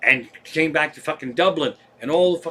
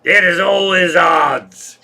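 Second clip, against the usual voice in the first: put-on loud, slow, drawn-out drunken proclamation; drunken guy; 3 semitones higher, 8 dB louder, slower than usual. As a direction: bellow it like a drunk at the bar. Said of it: "the drunken guy said".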